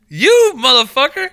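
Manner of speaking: high, loud, slow hammed-up accusation; mock accusing yell. Mock-yelling back at his teasing friend. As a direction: drag it out loud and high like a playful outburst.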